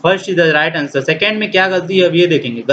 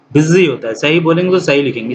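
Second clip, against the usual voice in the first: ordinary talk, nothing marked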